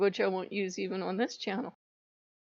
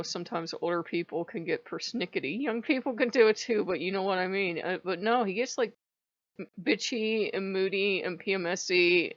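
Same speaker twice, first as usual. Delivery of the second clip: plain reading voice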